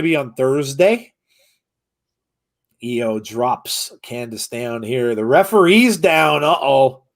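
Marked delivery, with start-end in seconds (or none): 2.8-7.0 rising excited ring call; wrestling commentator. Acted. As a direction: build up calling the action. Live play-by-play of the match.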